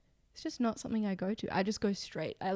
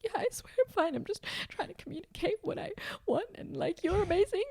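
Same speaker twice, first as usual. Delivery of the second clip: much higher, half-whispered, welling up; high teary reassurance; 10 semitones higher than usual. Retold or imitated. Girlfriend tearfully reassuring her partner, high and breathy. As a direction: squeak it out while trying not to cry. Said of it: "And I'm like".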